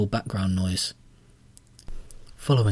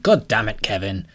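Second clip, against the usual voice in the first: exasperated outburst; 4 dB louder than usual. A narrator's reading.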